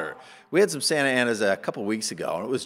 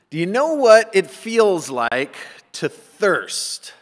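preaching question; 7 semitones higher, 7 dB louder, slower than usual. Real, not acted. Preacher's rhetorical challenge.

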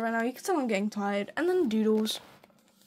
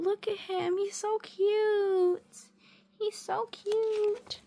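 adoring coo; 9 semitones higher, 3 dB quieter, slower than usual. Real, not acted. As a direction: coo softly up high, stretching the words. Cooing over a cute drawing.

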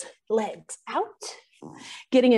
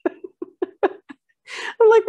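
laughing; 11 semitones higher, 3 dB louder than usual. Real, not acted. Laughing at the meme.

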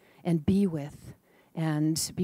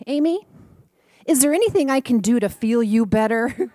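put-on higher and louder, eager child's offer; earnest little boy; 5 semitones higher, 8 dB louder than usual. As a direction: ask it like an eager four-year-old. Boy's mixed-up sentence voiced.

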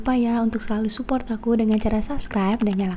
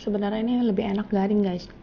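eating mid-sentence; talking while chewing; 5 dB quieter, faster than usual. Real, not acted. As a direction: mouth partly full of bread. Seen 1: Puts bread in mouth repeatedly; chews between phrases throughout.

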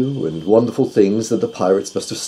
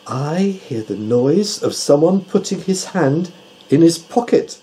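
slow, higher-pitched owl voice; precise, deliberate report of a tiny sound; Tutu the owl; 8 semitones higher than usual. A narrator's reading. Owl tells doctor what it hears, deliberate and high. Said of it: "said the owl".

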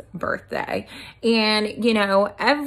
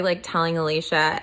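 ordinary talk, nothing marked

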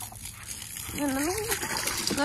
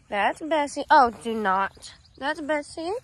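normal talking voice

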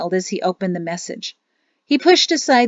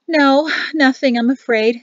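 sighing; 7 semitones higher, 6 dB louder than usual. A narrator's reading. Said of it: "She sighed".

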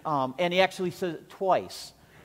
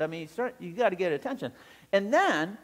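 ordinary delivery

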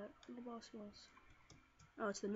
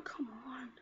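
ordinary delivery

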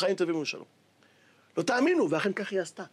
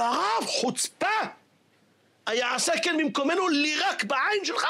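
outraged students; 6 semitones higher than usual. Acted. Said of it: "אמרו לו".

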